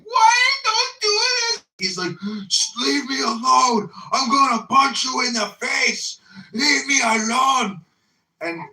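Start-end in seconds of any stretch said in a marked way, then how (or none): 0.0-1.6 high-pitched drawn-out mock scream; imitated scream. Neighbor mimics a woman in a passing couple, screaming. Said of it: "she was screaming". 2.5-7.9 loud, high, drawn-out mock yell; imitated shouting. Retelling a passing man's fight with his partner, mock shouting. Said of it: "she was screaming".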